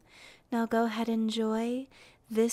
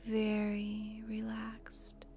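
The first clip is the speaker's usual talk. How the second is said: guided meditation; 4 dB quieter than usual. Acted.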